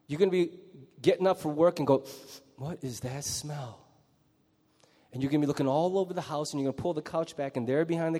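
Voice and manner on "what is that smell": soft, slow, puzzled muttering; mild disgusted half-asleep question; puzzled sniff. Pretend. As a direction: quietly wonder at a foul smell. Waking to a bad smell.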